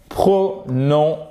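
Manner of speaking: "prononciation" slowly split into separate syllables. Shown as a demonstration. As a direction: break "prononciation" up, pausing between syllables. Teacher breaks word into syllables. (« prononciation » lentement, syllabe par syllabe)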